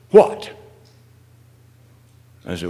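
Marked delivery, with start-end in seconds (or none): 0.1-0.5 sudden loud, high exclamation; retold sharp retort. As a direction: burst out with a mock-indignant one-word retort. Retelling his own sharp retort.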